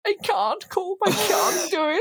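high, whiny wail imitating Orla; mock wailing Orla. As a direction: wail it high like she's about to cry. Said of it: "Orla's just like".

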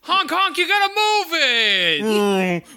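high laughing shout at a car; yelling at traffic. Real, not acted. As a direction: holler out the window.

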